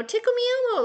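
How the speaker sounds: high toy voice; talking toy voice. Retold or imitated. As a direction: chirp it like a kid's talking toy. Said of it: "childish kinda game voices".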